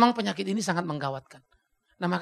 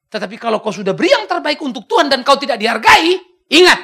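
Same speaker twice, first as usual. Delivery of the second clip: preaching climax; 5 semitones higher, 13 dB louder than usual. Real, not acted. Sermon climax.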